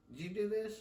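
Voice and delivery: lightly voiced dad, quietly menacing; father confronting his son over the wall hole, mildly voiced; dad questioning. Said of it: "he goes".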